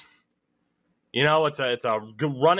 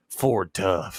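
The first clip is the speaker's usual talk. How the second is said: deep ad slogan; 4 semitones lower than usual. Pretend. Truck ad slogan parody.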